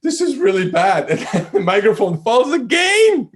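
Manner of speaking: high, loud laughing disbelief; laughing near tears at the memory. Real, not acted. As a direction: laugh helplessly as it goes wrong again. Microphone falls again, laughing.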